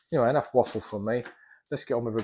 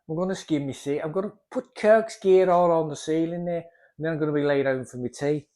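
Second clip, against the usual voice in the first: higher, put-on Scouse accent; 6 semitones higher, 6 dB louder than usual. Plasterer announcing his ceiling job in a mock Scouse accent. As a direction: do a rough, hammed-up Scouse impression. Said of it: "that's my excuse for, uh, a Scouse accent".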